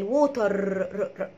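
"ووتر" with the final r trilled and repeated; mock the wrong "ووتر" by rolling its r; leaning on the R. Shown as a demonstration. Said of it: "وبيتكوا على الار بقى كمان".

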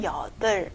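여덟 said yeo-deol, ending on l; left-consonant reading. Shown as a demonstration. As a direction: read 여덟 with the ㅂ silent. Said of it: "发这个左边的音".

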